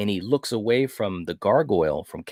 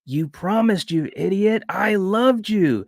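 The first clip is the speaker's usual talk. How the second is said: hurt angry cry; 10 semitones higher than usual. Retold or imitated. Wife confronting husband who broke his promise, pitched-up hurt yell. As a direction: imitate a betrayed wife crying out. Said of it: "And she's like".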